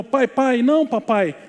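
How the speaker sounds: high, loud pleading that builds in pitch; imitated child's shouting. Pastor imitates boy pleading with father in the water, shouted protest. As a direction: act out a boy crying out in refusal to his father. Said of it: "e ele gritava".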